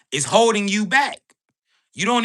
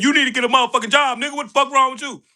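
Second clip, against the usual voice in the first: fast, higher, heated telling-off; mock angry scolding; 5 semitones higher, much faster than usual. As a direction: fire it off like an exasperated elder ranting. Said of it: "Motherfucker looked at you and said".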